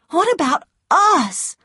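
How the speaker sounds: put-on high, slow young woman's plea; Scarlet. A narrator's reading. Scarlet asking Ashley about their future, high plea. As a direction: lift the voice high and ask slowly. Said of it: "Scarlet's voice in the story".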